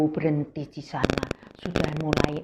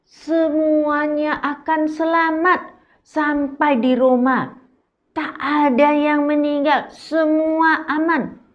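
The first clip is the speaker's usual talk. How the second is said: reassuring promise; 7 semitones higher, 4 dB louder than usual. Acted. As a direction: promise everyone safety. (janji yang menenangkan)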